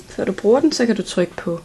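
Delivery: usual speaking voice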